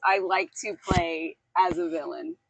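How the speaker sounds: normal talking voice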